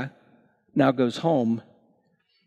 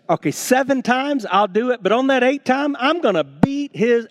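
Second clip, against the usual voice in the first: higher, louder Peter itching for a fight; ready to fight; 10 semitones higher, 4 dB louder than usual. Retold or imitated. Preacher acting out Peter's grudging patience before his fight. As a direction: bristle like a man ready to brawl. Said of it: "he's ready to fight".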